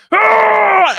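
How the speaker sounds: high loud mock wail scream; imitated scream cry. Imitating friend scream-crying at Endgame, loud wail. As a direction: belt out a sobbing scream like his friend. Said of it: "he was just going".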